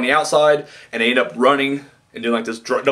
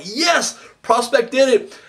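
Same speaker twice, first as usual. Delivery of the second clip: high, elated fan cheer; excited cheer; 11 semitones higher, slower than usual. Retold or imitated. Fan cheering as Prospect seems to win, excited. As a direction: celebrate like your team just won.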